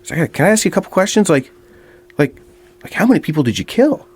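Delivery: lightly voiced eager fan asking questions; eager bar guy. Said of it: "He says".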